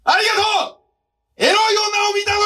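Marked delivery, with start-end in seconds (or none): 1.4-2.4 high excited shout; shouted prompt. Real, not acted. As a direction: yell it out high. (高い声で叫ぶ)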